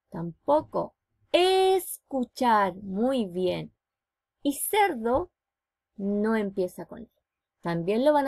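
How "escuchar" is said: "Escuchar" very slow, opening E stretched out; "Escuchar" said slowly with a long clear E; over enunciating. Shown as a demonstration. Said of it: "comienzan con el sonido E".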